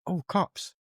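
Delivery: like spotting a rare band shirt in town; surprised recognition. Retold or imitated. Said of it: "someone went".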